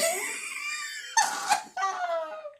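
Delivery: a very high, shrieking burst of laughter; cracking himself up over the band name. Real, not acted. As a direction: howl with squealing laughter. Laughing at own joke.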